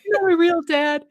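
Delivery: mock crying teen telling off his foster dad; whiny teen. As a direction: whine it like a sulking kid. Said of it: "whinier redo of the teen quote".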